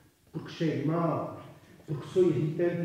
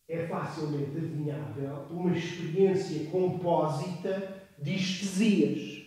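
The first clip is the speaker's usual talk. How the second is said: ordinary talk, nothing marked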